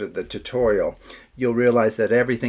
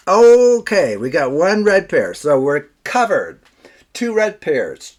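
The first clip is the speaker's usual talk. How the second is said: higher, louder, relieved; excited reveal; 4 semitones higher, 7 dB louder than usual. Real, not acted. Magic reveal succeeds.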